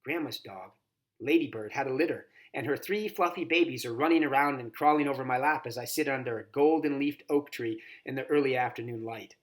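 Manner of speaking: ordinary delivery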